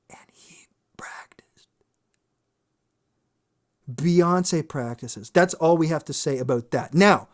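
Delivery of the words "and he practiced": whispered aside. Real, not acted. Punchline aside.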